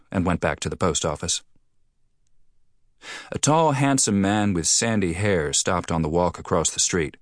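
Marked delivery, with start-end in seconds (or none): none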